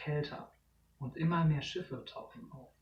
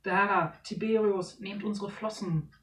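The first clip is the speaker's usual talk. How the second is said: higher, louder urgent call; 4 semitones higher, 4 dB louder than usual. Acted. Parents trying to pull the children free, urgent. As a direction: reach for them.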